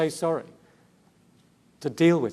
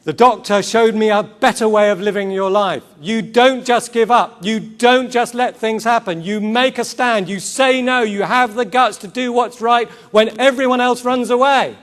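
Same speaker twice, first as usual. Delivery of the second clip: impassioned declaration; 8 semitones higher, 9 dB louder than usual. Retold or imitated. Preacher voicing Rose's rallying speech.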